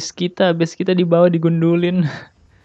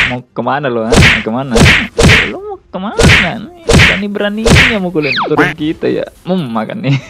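taunting; 4 semitones higher, 8 dB louder than usual. Real, not acted. Chasing enemy, taunting.

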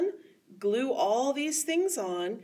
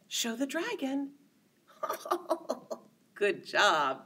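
ordinary delivery

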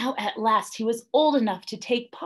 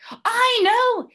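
very high, loud excited cry; shouted; 7 dB louder than usual. A narrator's reading. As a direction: burst out with the idea. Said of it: "he shouted".

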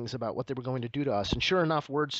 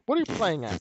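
British phrasing; 3 semitones higher than usual. Retold or imitated. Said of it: "very British thing for this Montreal guy to say".

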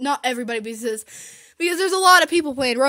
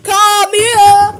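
calling out; 5 semitones higher, 11 dB louder than usual. Real, not acted. Calling baby sister.

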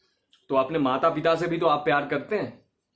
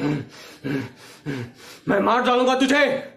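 clichéd angry shout; 3 semitones higher than usual. Shown as a demonstration. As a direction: growl then blast the threat. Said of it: "गुस्सा करने लगते हैं".

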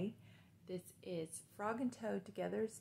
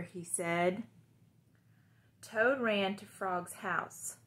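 put-on reading to children; 5 dB louder than usual. Teacher reads Frog and Toad aloud to the class.

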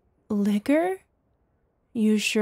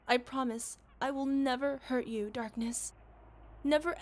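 soft, hushed loving words; tender murmur; 7 dB quieter than usual. Acted. Comforting her beloved Darkness, tender and soft. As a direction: hold him close and murmur. Tender love after confession.

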